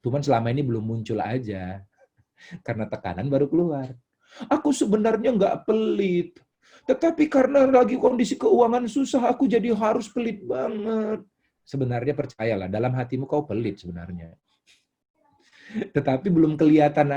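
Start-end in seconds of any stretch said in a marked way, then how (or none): 4.4-11.2 self-excusing believer. Acted. Church member blames money troubles for stinginess, acted high and protesting. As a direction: plead innocence in a much higher voice. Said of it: "Kalau saudara bilang".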